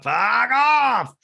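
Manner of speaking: high, loud fuck off; real frustrated outburst; loud exasperated yell. Real, not acted. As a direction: let it rip at her. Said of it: "angry outburst at Erica".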